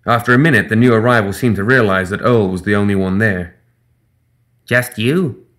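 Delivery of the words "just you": lightly voiced gremlin question. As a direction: sound a bit surprised.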